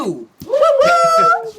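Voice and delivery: excited cheer. Real, not acted. Hyping up the guest intro.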